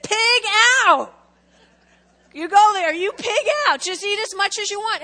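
loud, high, booming call to feast; big invitation. Retold or imitated. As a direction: throw the doors open and shout it out. Jesus voiced at the buffet.

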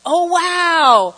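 awed oh wow, drawn out; mock amazed. Congregation member amazed by the verse, awed. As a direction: act out the listener's amazement. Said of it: "you're gonna go".

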